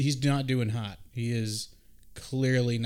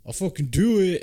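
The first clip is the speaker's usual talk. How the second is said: defiant yell; 6 semitones higher, 7 dB louder than usual. Retold or imitated. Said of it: "And Dev's like".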